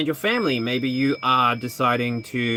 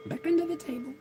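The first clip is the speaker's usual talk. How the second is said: very high cooing; baby talk to pet; 8 dB quieter, much faster than usual. Acted. Putting her pet back under the table, very high.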